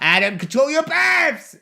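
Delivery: high, drawn-out shout of protest; exclaimed scolding. Real, not acted. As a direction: holler a mock-outraged telling-off. Scolding a burping castmate.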